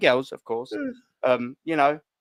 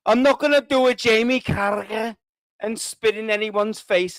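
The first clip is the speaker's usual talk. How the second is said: mock Scouse accent; 3 semitones higher than usual. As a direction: put on a hammed-up Liverpool accent. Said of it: "Is that a Scouse accent?".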